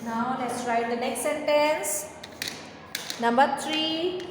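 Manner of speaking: slow, stretched class instruction; slow dictation. Shown as a demonstration. Teacher slowly announcing next sentence to class. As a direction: draw out each word for young writers.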